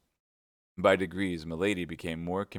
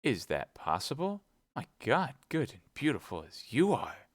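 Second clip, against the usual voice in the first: put-on surprise, pitched higher; feigned surprise; 6 semitones higher than usual. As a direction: fake astonishment to flatter her. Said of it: "affecting surprise".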